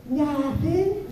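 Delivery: put-on high sing-song little kid denial; innocent kid. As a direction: squeak it out like a guilty toddler. Kid voiced denying.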